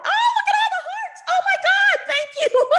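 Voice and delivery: very high, loud squeal of overjoyed thanks; real burst of giddy, high-pitched delight; delighted shriek. Real, not acted. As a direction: squeal it through laughter, thrilled to bits. Hearts appear, she shrieks with delight.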